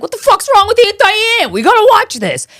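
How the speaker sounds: high, loud incredulous outburst; excited disbelief. Retold or imitated. Reacting to a documentary title, loud high mock outrage. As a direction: blurt it out, hooked on the title. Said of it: "And I was like".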